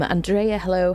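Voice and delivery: usual speaking voice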